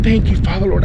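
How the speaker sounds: high, elated thanks to God; thanking God for the sign, elated; thrilled gratitude. Retold or imitated. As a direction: pour out joyful thanks.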